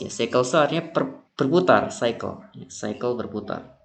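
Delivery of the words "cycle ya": ordinary delivery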